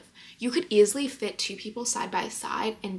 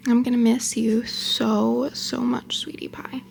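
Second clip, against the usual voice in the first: tender pet talk; 2 semitones higher, 5 dB louder, slower than usual. Real, not acted. Saying goodbye to her pet.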